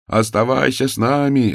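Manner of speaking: an echo from the crowd; calling out. A narrator's reading. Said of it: "раздавалось со всех сторон".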